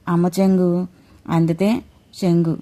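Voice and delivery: ordinary delivery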